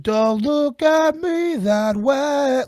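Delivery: high, slow falsetto imitation; singer impression. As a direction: stretch it out, high and thin, like a 2000s rock singer. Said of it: "he said".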